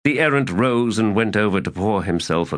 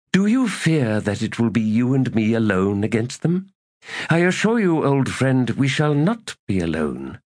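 a lightly voiced errant, higher and insistent; scheming elder god; 6 semitones higher than usual. A narrator's reading. Errant to Knuckles, lightly voiced and insistent. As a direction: coax an old ally back to power.

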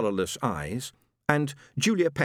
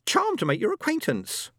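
much higher, prim and nervous; squeaky voice; 14 semitones higher than usual. Acted. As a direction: keep squeaking politely. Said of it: "squeaked Julia".

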